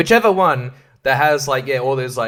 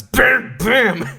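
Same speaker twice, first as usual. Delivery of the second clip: comic bam; 10 semitones higher, 6 dB louder than usual. Pretend. Comic sound effect.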